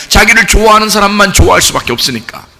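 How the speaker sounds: ordinary talk, nothing marked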